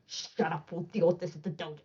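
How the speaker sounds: mock curt dismissal, held down; retold anger. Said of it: "he was so mad at me".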